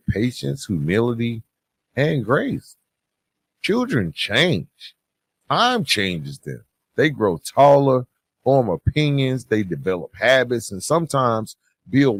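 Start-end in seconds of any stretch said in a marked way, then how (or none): none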